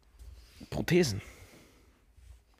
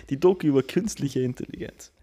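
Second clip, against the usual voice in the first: ordinary talk, nothing marked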